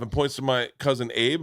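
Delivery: ordinary talk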